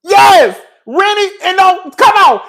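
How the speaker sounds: high, loud yes and come on; excited outburst. Real, not acted. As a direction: burst out in disbelief.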